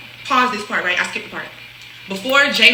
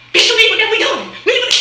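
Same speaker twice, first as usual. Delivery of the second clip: mock boyfriend yell; 10 semitones higher, 3 dB louder than usual.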